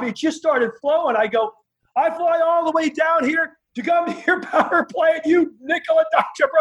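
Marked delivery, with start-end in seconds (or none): 2.0-6.5 high yelled insult; angry shout. Retold or imitated. Young wrestler yelling insults at the promoter, furious. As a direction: shout it at him, half laughing. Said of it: "calling him every name".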